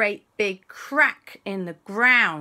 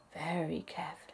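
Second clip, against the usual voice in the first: tense hushed whisper; 12 dB quieter, much faster than usual. A narrator's reading. Narrator tiptoes through the climb, whispering. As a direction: hold your breath and whisper it. Tension while climbing tree.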